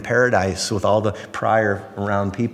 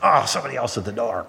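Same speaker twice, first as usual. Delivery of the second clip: crabby; faster than usual. Acted. Pastor imitates himself answering the door when worn out, crabby. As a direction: act out a grumpy, put-upon outburst. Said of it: "I get real crabby".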